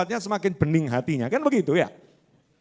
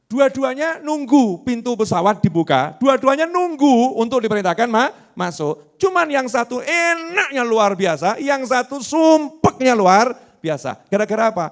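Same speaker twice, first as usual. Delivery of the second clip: preaching at full force; 7 semitones higher, 6 dB louder than usual. Acted.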